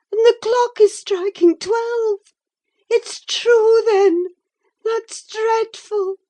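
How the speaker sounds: high, loud, near crying; horrified, trembling realisation; terrified. A narrator's reading. Catherine in terror at midnight, high and crying.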